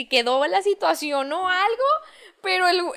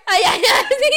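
normal talking voice

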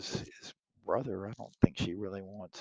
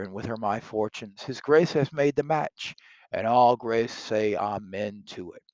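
reading Shakespeare aloud, louder and livelier than the commentary; dramatic play reading; 3 semitones higher, 9 dB louder than usual. Acted. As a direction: perform the verse for the class.

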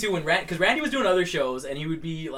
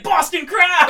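much higher, louder triumphant yell; wrestler's punchline shout; 14 semitones higher, 9 dB louder, much slower than usual. Retold or imitated. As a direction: yell the punchline as you flip him. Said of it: "he's like".

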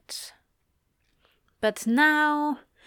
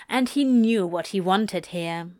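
usual speaking voice

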